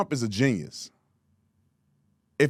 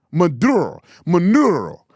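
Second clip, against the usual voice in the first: Maduro with a rolled Spanish R, louder; mock Spanish accent; 5 dB louder than usual. Shown as a demonstration. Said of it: "It's not Maduro".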